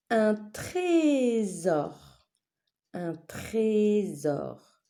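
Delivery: "trésor" very slowly as TRÉ-SOR; syllable-by-syllable. Shown as a demonstration. Teacher naming a picture for pupils, syllable by syllable. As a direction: stretch TRÉ long, then SOR.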